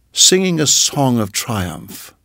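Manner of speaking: plain reading voice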